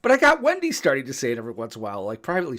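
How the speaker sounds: ordinary talk, nothing marked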